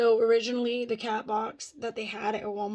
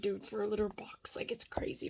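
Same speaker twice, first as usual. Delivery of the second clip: whisper; 5 dB quieter than usual. Retold or imitated. Retelling her reaction to the price.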